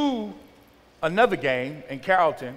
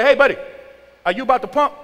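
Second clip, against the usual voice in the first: loud, raised hail to a stranger; calling out; 7 semitones higher, 7 dB louder, faster than usual. Retold or imitated. Driver to man at the pump, loud impatient hail. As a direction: call across the pumps, acting it out.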